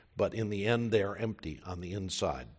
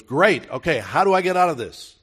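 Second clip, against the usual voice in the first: louder, higher, hurried relief; eager relief; 7 semitones higher, 11 dB louder than usual. Retold or imitated. Dangling man to God, loud eager relief. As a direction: rush it out, half laughing with relief.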